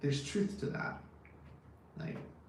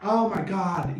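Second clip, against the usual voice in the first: louder, higher burst of real surprise; real startled exclamation, louder; surprised; 3 semitones higher, 7 dB louder, slower than usual. Real, not acted. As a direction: react like you just read something amazing. Reacting to viewer's birth year.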